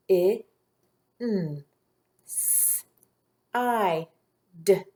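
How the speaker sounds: isolated sounds of I, N, S, I, D; sounding out. Shown as a demonstration. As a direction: leave a pause after each single sound. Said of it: "I'll say the sounds".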